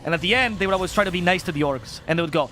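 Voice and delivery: ordinary delivery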